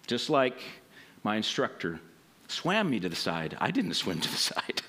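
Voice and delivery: soft, low preaching; quiet sermon close. Acted. Pastor closing the message to the congregation, soft and low. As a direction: draw the congregation in softly.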